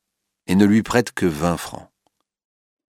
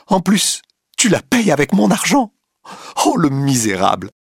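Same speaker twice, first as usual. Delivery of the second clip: cries out; 8 semitones higher than usual. A narrator's reading. Clotilde in the cab, screaming insults at Duroy. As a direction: shriek your betrayal and disgust. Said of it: "crie Madame de Marelle".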